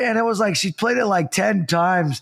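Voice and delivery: ordinary delivery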